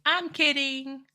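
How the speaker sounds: high, sing-song "I'm kidding"; a light, teasing retraction; playful high kidding. Real, not acted. Repeating a joke retraction.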